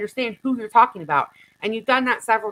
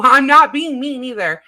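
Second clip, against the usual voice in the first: laughing through the words, louder and higher; 5 semitones higher, 8 dB louder than usual. Real, not acted. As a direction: laugh it off while insisting. Streamer laughs defending herself.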